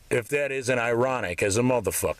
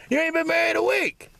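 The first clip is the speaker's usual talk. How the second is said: much higher, shrieking mock disbelief; mocking squeal. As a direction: squeal the taunt way up high. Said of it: "people started asking me".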